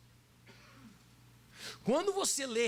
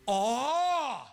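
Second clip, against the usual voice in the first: a big louder "Ó" held long; loud drawn-out Ó; 2 semitones higher, 6 dB louder than usual. Shown as a demonstration. Preacher showing the congregation how to read Jesus' "Ó", louder. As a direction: call out "Ó" like an amazed exclamation. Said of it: "leia com um bom tom de voz aquela letra O com acento".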